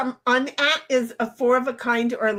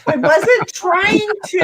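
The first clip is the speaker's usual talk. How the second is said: wailing protest, slowed down; mock whine; 8 semitones higher, 6 dB louder than usual. Real, not acted. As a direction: cry out in mock hurt. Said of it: "let's just rub it in".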